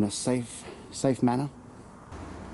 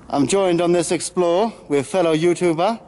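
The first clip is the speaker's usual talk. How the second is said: louder and higher, mock host introducing a guest; hyped YouTuber intro; 6 semitones higher, 6 dB louder than usual. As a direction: play the overexcited channel host presenting his co-star.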